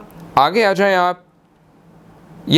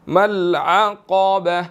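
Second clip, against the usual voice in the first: slow, drawn-out chanted recitation; measured tilawat before the lesson; Quranic recitation; much slower than usual. Shown as a demonstration. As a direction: recite it slowly, stretching each vowel. Recites verse fragment.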